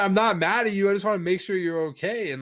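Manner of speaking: loud, high-pitched retold insistence; emphatic mock pleading. Boyfriend retelling his plea to silent girlfriend, emphatic. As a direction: insist loudly, pitched well up.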